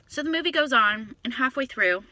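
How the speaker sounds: usual speaking voice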